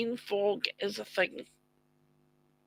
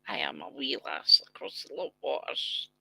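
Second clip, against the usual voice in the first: much lower, quick leprechaun voice; 10 semitones lower, 6 dB quieter than usual. Pretend. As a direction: drop deep and rush it, trailing to a whisper. Halloween special, lower retake of line.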